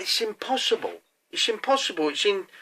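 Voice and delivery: high, soft mock protest; disbelieving protest. Angler answering the French lad's claim on his line, high disbelieving protest.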